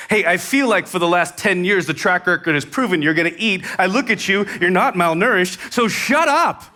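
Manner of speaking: exasperated parent. Retold or imitated. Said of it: "you would want to say something akin to this".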